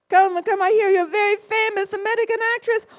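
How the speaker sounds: put-on high, loud, fast Italian accent. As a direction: gush in a thick Italian accent. Fellini voiced, high, loud.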